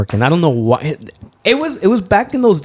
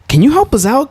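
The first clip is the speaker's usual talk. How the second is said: higher asking for help; eager plea; 13 semitones higher than usual. Retold or imitated. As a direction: flag the officer down eagerly. Asking CHP officer for help.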